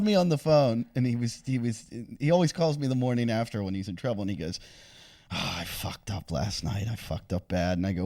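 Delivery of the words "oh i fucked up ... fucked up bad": lower, softer, slower rueful groan; mock remorse. Host imitates Jim's guilty morning-after phone call, low mock groan. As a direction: imitate a hungover, guilty confession.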